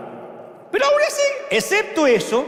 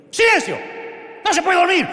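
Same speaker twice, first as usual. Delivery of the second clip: scolding shout; 11 semitones higher than usual. Acted. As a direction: yell at the storm like a grumpy parent. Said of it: "le grita a la tormenta como si estuviera regañando". (regaño a gritos, agudo y fuerte)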